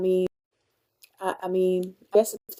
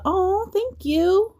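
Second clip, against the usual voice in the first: touched thanks; 12 semitones higher, 3 dB louder, much slower than usual. Real, not acted. Thanking viewer for roses.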